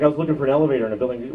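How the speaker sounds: ordinary talk, nothing marked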